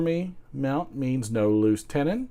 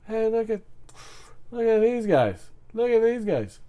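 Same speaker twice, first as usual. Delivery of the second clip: Eddie Izzard; 11 semitones higher than usual. Acted. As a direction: point them out in a high British comic voice. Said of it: "my take on the British accent".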